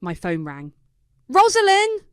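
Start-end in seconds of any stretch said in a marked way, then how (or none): 1.3-2.0 high, loud furious yell. A narrator's reading. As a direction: yell her name in rage. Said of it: "Paisley's voice was so loud".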